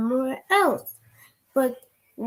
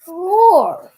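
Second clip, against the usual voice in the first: loud roar; 6 semitones higher, 10 dB louder than usual. Acted. Child roars in story.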